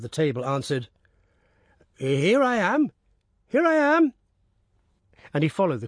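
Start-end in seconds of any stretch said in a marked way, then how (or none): 2.0-4.1 put-on eager old peasant. Old peasant answers corporal.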